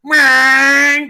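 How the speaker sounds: loud, high engine whine; drawn-out "meee-ang" vroom; car speeding off. Retold or imitated. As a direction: imitate a car tearing away. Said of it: "he, like, took off".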